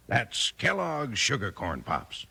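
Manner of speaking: normal talking voice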